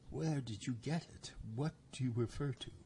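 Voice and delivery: snake voice lifted high, uneasy. A narrator's reading.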